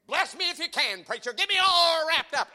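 hammed-up challenge to the preacher; mock bored churchgoer. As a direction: lampoon the unmoved pew-sitter. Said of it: "They're saying, they're saying".

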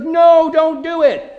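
high, loud, drawn-out plea to stop; acted warning yell. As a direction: yell it as if helplessly watching disaster. Yelling at yourself to stop.

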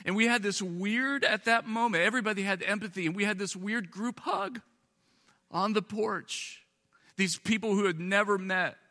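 impassioned high preaching; preaching at full force. Acted. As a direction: lift the crowd with rising fervour. Preacher's emotional climax.

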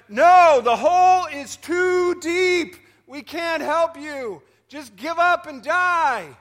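high, loud and slow, dismissive; drawn-out loud call of give up; discouraging frogs. Acted. Frogs at the rim telling frogs below to give up, high and slow.

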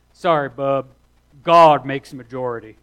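loud backwoods Alabama drawl. Retold or imitated. Quoting a classmate's retort to the preacher, loud Alabama drawl. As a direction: boom it out in a broad Alabama accent. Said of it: "my best Alabama drawl".

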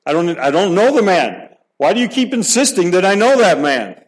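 high, loud, exasperated outburst; angry denial. Acted. Peter cursing at the bystanders, angry and loud. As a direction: fling the denial back at them.

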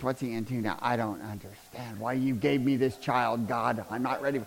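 soft, low mumbling inner voice; distracted churchgoer. Imitating churchgoers thinking of chores, muttered. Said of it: "y'all are sitting here going".

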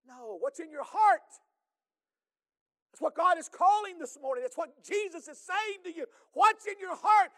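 full-force preaching. Acted. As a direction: preach it out to the congregation. Closing preached call.

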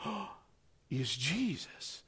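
low, slow, hushed recognition; hushed awe. Acted. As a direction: breathe it out in wonder. John recognizing Jesus, awed.

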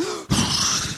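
long, wordless exhale of frustration; frustrated sigh. Acted. Preacher acting someone frustrated beyond words, heavy sigh. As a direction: act out being too frustrated for words. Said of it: "you've been so frustrated and you're like".

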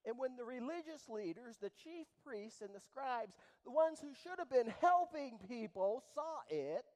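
loud swelling preacher's cry; preaching at full force. Acted. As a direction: build it up and hurl it out.

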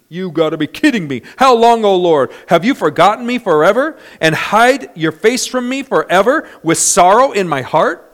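acted loud, high lament; David. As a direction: plead in protest, building from disbelief to a shouted complaint. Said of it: "give you the David of Psalm thirteen".

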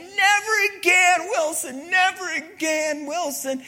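drawn-out, high-pitched yell. Acted. Castaway yelling for his lost volleyball, loud high yell. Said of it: "And he's yelling".

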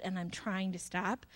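quiet, sincere trailing apology; soft apology. Retold or imitated. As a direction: say it gently as the husband's apology.

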